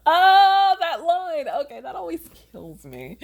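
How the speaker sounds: laughing through the words, high and loud; cracking up at her own line; real laughter. Real, not acted. Author laughing at her own writing while reading it aloud.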